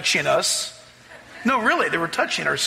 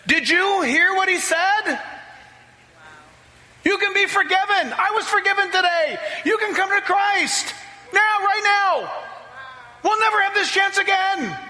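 much higher, urgent ringing call; fervent plea; 13 semitones higher than usual. Retold or imitated. New convert Kaha urging his family to accept Christ, much higher fervent shout. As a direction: plead with the room to come now. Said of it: "Kaha stood up and said".

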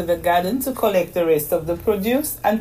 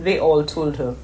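plain reading voice

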